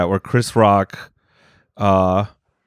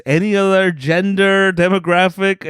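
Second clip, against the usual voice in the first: ordinary talk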